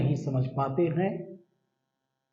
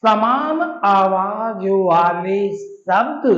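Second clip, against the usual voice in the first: slow teaching emphasis; 5 dB louder, much slower than usual. Shown as a demonstration. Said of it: "teacher defining slowly".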